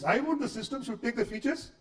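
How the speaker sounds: ordinary talk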